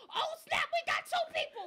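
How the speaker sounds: high, loud burst of real excitement; excited shout. Real, not acted. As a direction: shout it out, thrilled. Viewer count rises, excited outburst.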